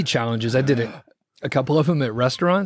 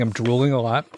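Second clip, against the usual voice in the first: usual speaking voice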